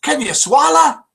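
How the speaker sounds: put-on Scottish accent. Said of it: "why he's got a Scottish accent".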